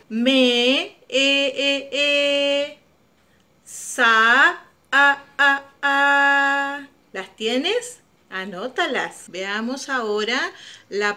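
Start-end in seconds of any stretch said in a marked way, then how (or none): 0.1-6.9 "Me, e, e, e, sa, a, a, a" with each vowel drawn out; stretched vowels. Shown as a demonstration. Teacher to child, drawing out the vowels of mesa. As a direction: hold every vowel of mesa long and even. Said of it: "Ahora busquemos la vocal".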